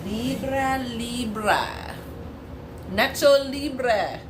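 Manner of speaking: high, loud drawn-out goofy call; hammed-up loud, high-pitched chant; playful mock hype. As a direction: belt the name out as a joke. Joking riff on the sign's name.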